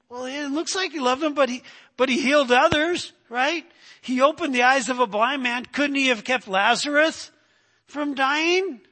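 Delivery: doubting bystander. Retold or imitated. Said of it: "the other group is saying".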